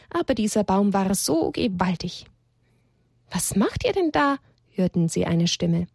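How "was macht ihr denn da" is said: higher-pitched surprise question from above; lightly voiced stranger calling down, higher; mysterious unseen voice. A narrator's reading. Unseen voice startles both characters.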